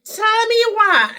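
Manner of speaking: high loud cry of disbelief; loud high tell me why; exasperated cry. Real, not acted. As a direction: throw it up to the heavens.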